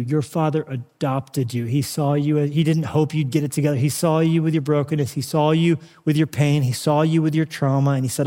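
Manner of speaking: normal talking voice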